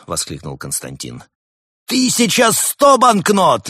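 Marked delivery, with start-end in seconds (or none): 1.9-3.7 high, loud, slow jubilant call; booming prize announcer. Acted. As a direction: crow the price to the hall. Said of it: "ликовал ведущий".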